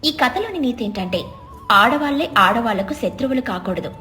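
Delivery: ordinary delivery, nothing marked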